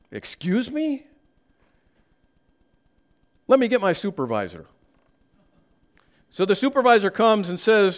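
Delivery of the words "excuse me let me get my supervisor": high, fast, officious angel; flustered angel. Acted. Angel at heaven's gate calling the supervisor, flustered. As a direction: hurry off as a flustered clerk to fetch the boss. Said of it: "the angel must have said".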